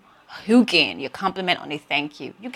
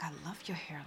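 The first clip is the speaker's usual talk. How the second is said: much softer, hushed compliment; casual compliment; 3 semitones lower, 22 dB quieter than usual. Retold or imitated. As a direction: toss it gently to a stranger. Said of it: "I'm like".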